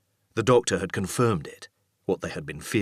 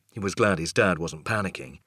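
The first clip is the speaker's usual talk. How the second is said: usual speaking voice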